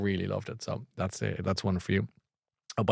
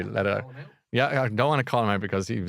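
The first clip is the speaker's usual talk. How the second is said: ordinary talk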